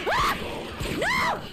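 high, loud frightened cry; panicked no. Real, not acted. Streamer chased by a monster, panicked shout. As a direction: yell it as it chases you.

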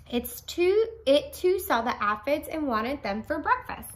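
put-on bright, lively read-aloud for children; storybook reading. A narrator's reading. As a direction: read it big for a class of kids. Teacher reads story aloud.

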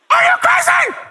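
Paul's outraged question in a high, shouted voice; high, incredulous acted yell; high-pitched shriek. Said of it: "Paul's in that high voice".

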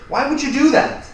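high, loud, incredulous question; appalled demand. Acted. Roommate learns favorite shirt went in dirt, appalled. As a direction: pitch shoots up in outraged disbelief. Upset over dirtied shirt.

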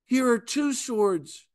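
high, proud offer of two swords; eager disciples. Retold or imitated. Disciples proudly showing Jesus two swords, high and eager. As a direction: hold out the swords like proud kids. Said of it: "they immediately say".

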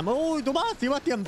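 high excited taunt; gloating cheer. Real, not acted. Gloating kill shot.

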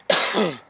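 sharp loud cough; one real hacking cough. Real, not acted. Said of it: "I'm gonna just cough a little bit".

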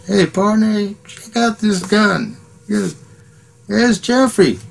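high-pitched put-on tour manager voice. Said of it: "Jimmy, the tour manager, goes".

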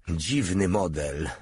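ordinary delivery, nothing marked

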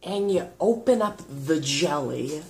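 normal talking voice

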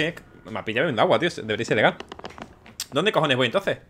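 ordinary delivery

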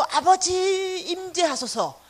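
imitating a congregation crying out in worship; mock worship cry. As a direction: lift it up like a fervent worshipper. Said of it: "손을 높이 들고".

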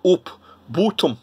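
rounded Yorkshire vowels, "oop, boottom"; Yorkshire accent. Shown as a demonstration. Trying Gaming Grandpa's region, rough Yorkshire accent. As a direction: attempt a broad Yorkshire accent. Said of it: "I think he's a Yorkshire".

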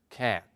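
"cat" with the long "ae" vowel; "cat" with a wide English a, unhurried; animal word contrast. Shown as a demonstration. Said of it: "cat es gato".

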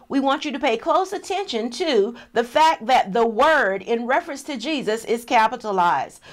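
ordinary talk

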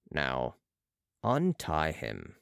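deep, slow order; Balsamo commanding. A narrator's reading. Balsamo orders the patient untied, low slow command. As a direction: sink low and measure out the command.

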